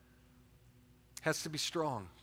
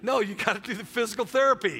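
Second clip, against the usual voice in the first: worked up; 6 semitones higher, 9 dB louder than usual. Retold or imitated. Worked up retelling rehab fight.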